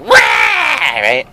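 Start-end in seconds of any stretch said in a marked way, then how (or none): 0.0-0.2 put-on baby wail. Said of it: "the baby's like".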